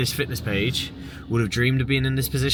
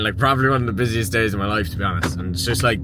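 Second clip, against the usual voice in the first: ordinary delivery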